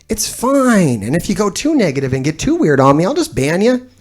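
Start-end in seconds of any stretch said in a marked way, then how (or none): none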